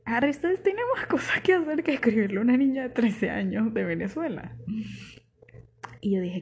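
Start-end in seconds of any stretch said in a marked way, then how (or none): 0.1-4.6 scoffing. Real, not acted. Mocking the absurd idea.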